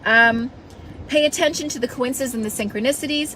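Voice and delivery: ordinary talk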